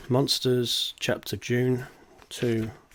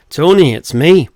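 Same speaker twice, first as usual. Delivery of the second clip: high, loud put-on accent; Paul's accent; 6 semitones higher, 13 dB louder than usual. Paul on the phone to Tony in a put-on accent. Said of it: "Forgive the accent".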